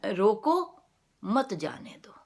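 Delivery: "रोको" drawn out alone, break, then "मत जाने दो"; pause after रोको. Shown as a demonstration. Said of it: "उसका स्ट्रेस चेंज कर दें".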